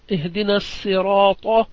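ordinary talk, nothing marked

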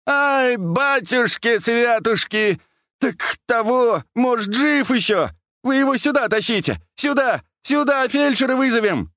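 frantic high-pitched hollering; panicked wailing. A narrator's reading. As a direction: cry out in flustered alarm. Old man frantic, wailing across water.

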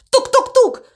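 very high, rapping imitation; urgent knocking sound. A narrator's reading. Narrator imitates knocking on glass.

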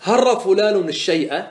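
normal talking voice

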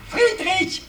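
high, ringing call for the servant. Acted. Master orders Friedrich to serve soup, calling out. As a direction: summon someone from another room, pitched high.

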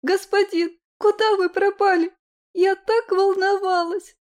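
put-on high child's voice near tears; tearful child's voice. A narrator's reading. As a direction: whimper it like a scared little girl. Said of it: "срывающимся на плач детским голоском".

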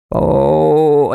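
loud rumbling troll call. Acted. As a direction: boom it like a troll. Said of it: "пророкотал голос тролля".

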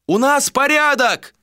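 very high, stretched-out call; distant answering shout. A narrator's reading. Schooner answers through the snow, high distant shout. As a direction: shout back across the water. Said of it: "донеслось из снежной пелены".

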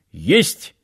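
ordinary delivery

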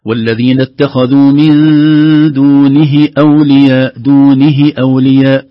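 slow, loud chanted recitation; Quran recitation. Shown as a demonstration. Teacher reciting a verse for students in chanted style. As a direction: intone it like tajweed reading.

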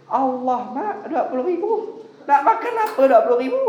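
high, whiny complaining wife; nagging wife. Retold or imitated. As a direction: whine like a wife disappointed with the money. Istri mengeluh soal uang. (istri mengeluh dengan suara tinggi merengek)

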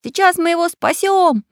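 high, loud rallying cry; determined shout. A narrator's reading. Boy promising to save his friend, determined. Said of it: "решительно закричал Чижик".

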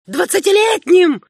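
двадцатилетним shrieked high and loud; an old man's furious outcry; shrieked repetition. Acted. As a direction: explode on двадцатилетним. Old emperor rants at son.

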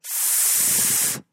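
a long unvoiced s; hissed s. Shown as a demonstration. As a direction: hiss an s with no voice. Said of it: "No sentirás ninguna vibración". (s sorda y prolongada)

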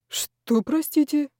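politely baffled, as if unsure you heard right; taken aback. A narrator's reading. Concierge reacts to a bizarre report, taken aback. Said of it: "опешил консьерж".

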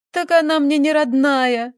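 high-pitched tearful protest; sobbing. A narrator's reading. Girl says aunt is no blood relation, wailing tearfully. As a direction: keep wailing, pitched well up. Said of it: "продолжала рыдать девушка".

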